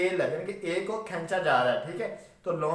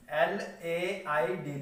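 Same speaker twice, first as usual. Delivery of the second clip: letters spelled out slowly and softly; slow spelling; 4 dB quieter, much slower than usual. Shown as a demonstration. Teacher slowly spells the word for the students, letter by letter. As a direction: spell each letter apart. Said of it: "लेड".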